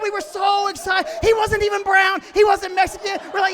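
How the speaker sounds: loud, high, breathless celebration; excited shouting. Acted. Comic tells the audience how they celebrated, loud and excited. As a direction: ride the joy up to a laugh.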